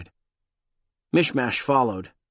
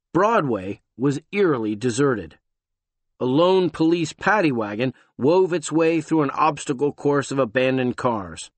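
usual speaking voice

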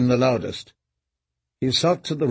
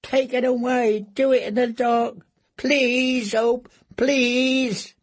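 much higher, pleading prisoner voice; much higher, desperate broken plea; terrified begging; 10 semitones higher, slower than usual. Acted. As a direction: beg them to kill you in the dark. Reek begs in terror.

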